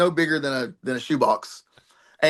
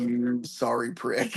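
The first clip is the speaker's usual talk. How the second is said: retold grudge; 3 semitones lower, 6 dB quieter, much slower than usual. Retold fuming wrestler muttering at him.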